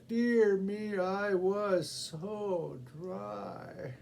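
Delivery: high, slow, moaning complaint; a parched woman's drawn-out moan; thirsty woman's lament. Acted. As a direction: drag out every dry. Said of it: "took up her song afresh".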